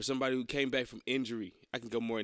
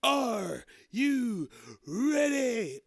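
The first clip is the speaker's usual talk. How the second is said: drawn-out ring announcer yell, much higher; announcer hype call; 10 semitones higher, 5 dB louder, much slower than usual. Pretend. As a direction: stretch every word like a ring announcer. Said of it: "Smoker's voice can't do it".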